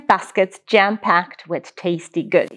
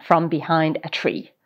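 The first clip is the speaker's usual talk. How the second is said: ordinary delivery, nothing marked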